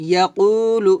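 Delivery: ordinary delivery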